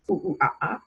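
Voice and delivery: quick monkey hoots; light monkey call for the class; monkey sound. Shown as a demonstration. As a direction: hoot like a playful monkey.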